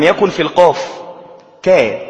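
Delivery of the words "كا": short isolated كا, made forward of the qaf; teacher sounding out كا; kaf articulation. Shown as a demonstration. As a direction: demonstrate كا with a clean k at the back of the tongue. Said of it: "تقترب من الشفتين عند نطق الكاف".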